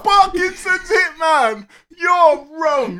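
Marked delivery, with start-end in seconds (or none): 2.0-3.0 high, loud cry of disbelief; playful outraged shout. Real, not acted. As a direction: shout him down in mock outrage. Mock outrage at friend's joke.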